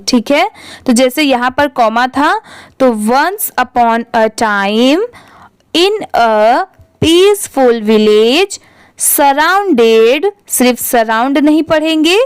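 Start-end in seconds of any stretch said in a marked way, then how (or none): 3.1-10.3 slow model reading with a clear pause after "time"; pausing at commas. Shown as a demonstration. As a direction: read word by word, resting at each comma. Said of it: "थोड़ा रुकना चाहिए". (धीरे पढ़ना, comma पर रुकते हुए)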